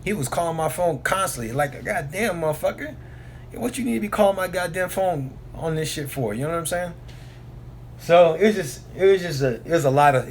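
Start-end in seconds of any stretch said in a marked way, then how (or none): 1.9-6.3 higher-pitched, exasperated retort without shouting; mock exasperation. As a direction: complain in a raised pitch about the nonstop calls. Fed up with constant calls.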